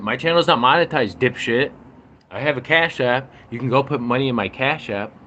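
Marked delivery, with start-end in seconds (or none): 0.0-3.8 raised, louder irritated snap; annoyed retort. Real, not acted. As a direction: tell him off sharply. Angry reply to commenter.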